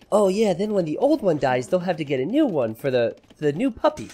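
sugary imitation of his girlfriend; cute tone. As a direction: say it sweetly, like it's nothing. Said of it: "in a cute tone".

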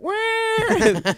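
high thin squealed wail; mock baby squeal; imitated baby wail. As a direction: imitate a newborn's cry, high and drawn out. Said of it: "and then it's like".

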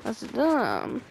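ordinary talk, nothing marked

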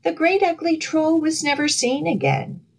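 ordinary delivery

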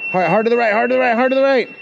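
high, insistent repeated commands; urgent. Real, not acted. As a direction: urge them to steer now. Urgent steering instructions.

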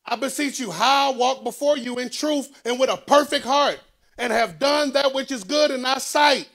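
high, loud sermon delivery; full-force preaching. Acted. Preacher telling the church of Hezekiah's prayer, full-force preaching.

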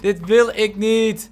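slow, stretched-out protest with weight on wil; mock-pleading slow repeat; drawn-out whine. Real, not acted. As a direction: drag every word of "Dit wil ik niet" out. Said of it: "repeats drawn out".

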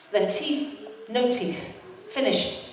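dropping to a soft, grave hush. Real, not acted. Telling the audience about Gaza children, hushed and grave. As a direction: let it sink in quietly. Grim detail of children's teeth.

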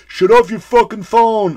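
loud, high angry heckle; imitated yell. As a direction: yell like a fed-up moviegoer across the theater.

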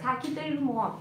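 ordinary talk, nothing marked

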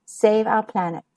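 plain reading voice

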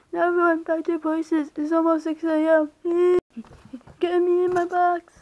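high, urgent puppet voice; rally everyone in a high, steady character voice; marionette character. Acted. Marionette sending animatronics back before six, high urgent voice.

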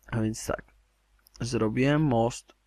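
ordinary talk, nothing marked

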